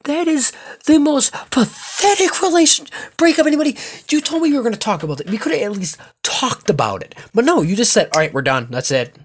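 held-back furious rant. Real, not acted. Venting at his ex at night, furious but holding back a yell. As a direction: rage at her but keep it under a shout. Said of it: "I would, I would yell, but".

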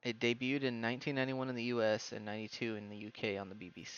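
soft, measured read-out; low-key encyclopedia reading; reading aloud. Real, not acted. Reads out an encyclopedia entry.

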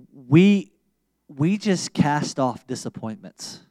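slow, earnest prayer with long pauses; closing prayer. Acted. Pastor praying over the congregation, slow and earnest. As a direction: pray it slowly and earnestly over the people.

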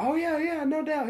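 very high mock encouragement; put-on, high-pitched agreement; playing along. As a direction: imitate someone cheerily agreeing without a clue. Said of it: "I'm just playing the fucking game".

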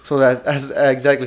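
ordinary delivery

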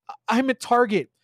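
plain reading voice

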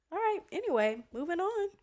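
normal talking voice

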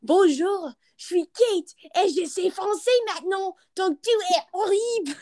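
high, showy French delivery; imagined smug French comeback. Pretend. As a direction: show off your French with sass. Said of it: "And I'll be like".

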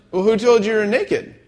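high, playful mock interrogation; questioning God. God quizzing the naked pair, mock questioning. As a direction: pitch the question up, playing a probing God.

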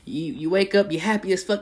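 ordinary delivery

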